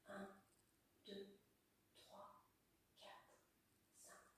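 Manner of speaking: counting with breath held; holding breath. Real, not acted. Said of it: "Je bloque".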